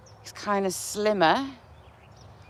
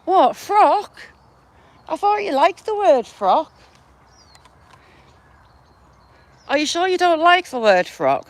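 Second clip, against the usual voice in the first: playful teasing; 8 semitones higher, 6 dB louder than usual. Real, not acted. Teasing someone about the word.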